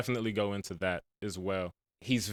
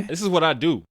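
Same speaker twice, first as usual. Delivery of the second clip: confident; 7 semitones higher, 7 dB louder than usual. Retold or imitated.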